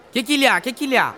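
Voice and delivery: innocent puzzled asking; feigned innocence. Pretend. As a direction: play dumb, high and questioning. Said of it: "en répondant chaque fois".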